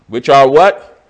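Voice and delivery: usual speaking voice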